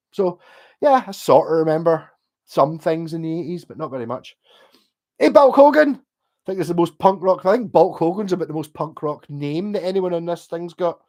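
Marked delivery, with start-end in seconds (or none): 5.2-5.9 loud, high shout-out to a viewer; calling out greeting. Real, not acted.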